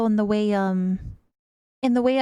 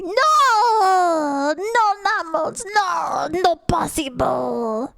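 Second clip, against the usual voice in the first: mock dramatic cry; 5 semitones higher, much slower than usual. Mock outrage at a chat comment.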